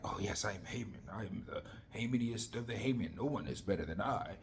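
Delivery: low, quiet, self-satisfied grandeur; Haman boasting. Acted. Haman at the queen's banquet, smugly boasting. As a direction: softly play a man in love with himself. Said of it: "Haman is there, like".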